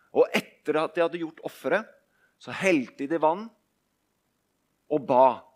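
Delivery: ordinary talk, nothing marked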